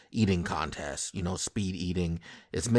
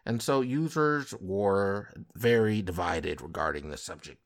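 normal talking voice

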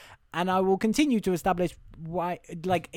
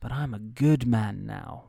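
slow, lightly earnest put-on voice; sigh, then plead it slowly and low; acted Tom Hanks line; 5 semitones lower, slower than usual. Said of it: "Tom Hanks looks at it and he goes".

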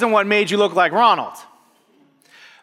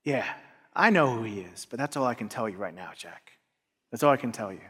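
secretive hush; 8 semitones lower, 10 dB quieter than usual. Retold or imitated. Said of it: "And I was just like".

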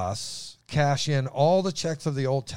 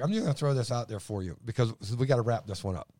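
ordinary talk, nothing marked

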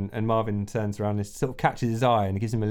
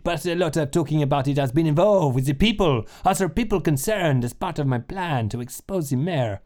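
put-on a light French accent; lightly voiced French strongman; 4 semitones higher than usual. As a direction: reporter keeping up his French disguise. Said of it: "the French accent".

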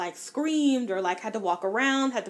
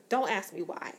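ordinary delivery

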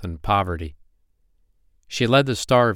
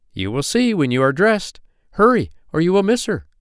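high, urgent chivvying, louder at the end; hurrying; 10 semitones higher, 4 dB louder than usual. A narrator's reading. Older fairies hurrying Poppy Pink to dress, urgent. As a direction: hustle the little one along.

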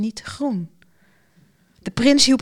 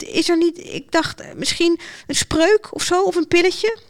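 put-on high-pitched, halting girl's plea; hesitant girl; 8 semitones higher than usual. A narrator's reading. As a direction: grope hopefully for a way out. Girl timidly seeks a shortcut.

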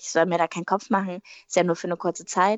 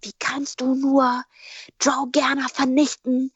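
mock outraged fan; 4 semitones higher, 3 dB louder, slower than usual. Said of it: "dass ich so Nachrichten bekommen habe".

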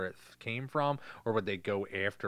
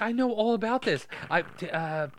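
put-on high little girl voice; 10 semitones higher than usual. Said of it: "She's like".

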